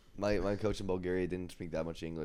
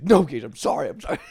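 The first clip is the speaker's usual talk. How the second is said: mock apology; 9 semitones higher, 15 dB louder than usual. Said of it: "He's like".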